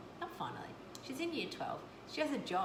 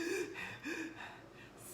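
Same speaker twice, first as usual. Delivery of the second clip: whispered gasp; 11 semitones higher, 4 dB quieter than usual. Retold or imitated. Acted shocked gasp.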